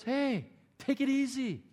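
slow, soothing grandmother telling new parents to relax; unhurried, settle the panicked couple down; calm reassuring mom. Retold or imitated. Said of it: "She goes".

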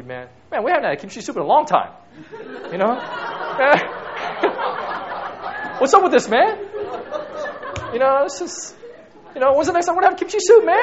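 loud, high mock demand, fast, laughing; demanding complaint. Shown as a demonstration. Husband demanding kimchi soup from wife, hammed-up complaint. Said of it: "The other way of saying it would be".

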